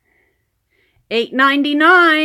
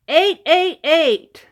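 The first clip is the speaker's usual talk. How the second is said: raffle caller; 3 semitones higher, slower than usual. Real, not acted. Repeating the winning number.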